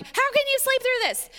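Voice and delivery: very high-pitched, incredulous outburst; mock outraged cry. Disciples waking Jesus in the storm, mock outrage. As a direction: act out alarm, pitch shooting up.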